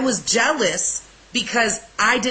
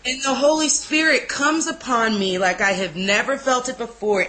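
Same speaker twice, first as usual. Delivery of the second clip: usual speaking voice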